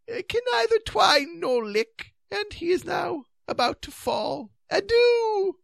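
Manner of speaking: high, wailing farewell; feeble, with aversion. A narrator's reading. Knight about to fall from the cliff, feeble and despairing. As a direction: falter in a high voice, building to a last wail. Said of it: "said Sir Palomides feebly, finally, and with aversion".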